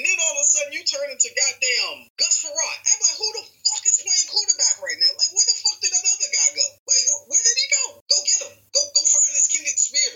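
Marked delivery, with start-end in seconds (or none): 2.8-10.1 exasperated rant. Real, not acted. Rising exasperation.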